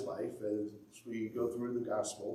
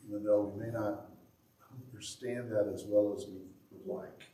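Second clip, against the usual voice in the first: prayer. Acted. Leads closing prayer.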